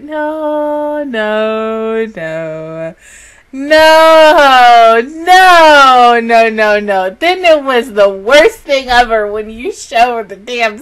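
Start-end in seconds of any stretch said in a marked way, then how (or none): 0.0-7.1 loud, pleading repeated no; anguished no. Real, not acted. Begging the show not to kill a favorite, loud. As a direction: deny it with all your might. Refusing a character's death.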